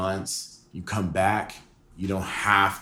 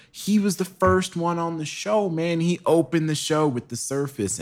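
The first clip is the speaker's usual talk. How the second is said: raised, eager voice; excited; 9 semitones higher than usual. Real, not acted. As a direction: hype up the memory. Hyped about guest's first show.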